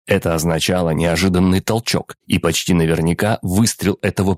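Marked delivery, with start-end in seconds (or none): none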